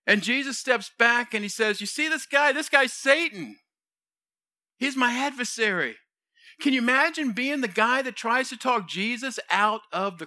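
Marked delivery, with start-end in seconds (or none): 1.8-6.0 higher-pitched, pointed accusation; indignant rebuke. Acted. Jesus rebuking Peter for rejecting the cross, indignant. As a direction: call him out in front of everyone.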